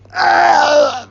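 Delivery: high-pitched strangled wail; choking scream. Acted. Cyril choking while Bozo shrugs, strangled scream. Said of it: "Cyril chokes in the background".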